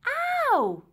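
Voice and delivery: very high, loud ow; sharp high cry of pain; pained yelp. Acted. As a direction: yelp as if bashed on the head. Girl cries out when hit.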